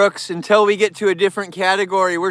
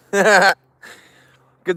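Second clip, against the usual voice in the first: laughing; 4 dB louder than usual. Real, not acted.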